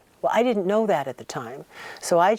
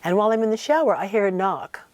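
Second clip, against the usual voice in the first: ordinary talk, nothing marked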